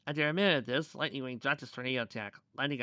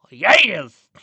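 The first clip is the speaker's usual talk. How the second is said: loud, raised-pitch cry of victory; triumphant shout; 6 semitones higher, 21 dB louder than usual. A narrator's reading. Lightning Wing seeing his chance to attack, triumphant shout. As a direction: burst out with it. Pony exults at his opening.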